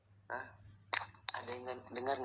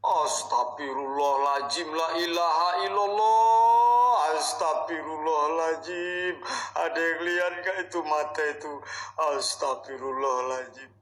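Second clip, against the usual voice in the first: panicked loud prayer; 4 semitones higher, 13 dB louder than usual. Real, not acted. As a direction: cry out the prayer in fear. Terrified prayer at eyes appearing. (berdoa keras dengan panik)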